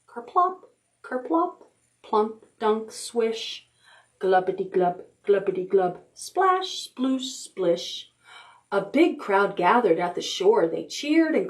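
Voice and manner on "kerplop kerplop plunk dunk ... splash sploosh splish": splashy water sounds. A narrator's reading. Reading to kids, sounding out the hippo swimming. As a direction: let the words plop like drops into water. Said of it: "it sounded quite a lot like this".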